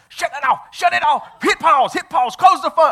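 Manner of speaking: high-pitched, loud, frantic mock shouting; frantic wife. Wife to husband as his phone reads aloud mid-funeral, frantic shouting. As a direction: imitate a panicked wife firing off urgent orders. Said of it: "his wife beside him is just going".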